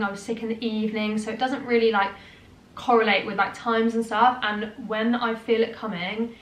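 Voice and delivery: ordinary delivery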